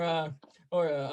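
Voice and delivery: usual speaking voice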